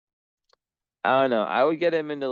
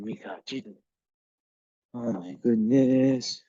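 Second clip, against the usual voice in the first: usual speaking voice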